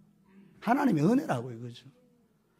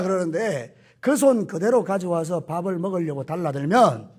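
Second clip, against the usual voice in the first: ordinary talk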